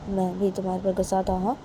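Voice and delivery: usual speaking voice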